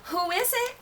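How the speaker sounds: high, sing-out call to the door; Granny calling out. A narrator's reading. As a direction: call out as an old granny, pitched well up. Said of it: "called out Granny".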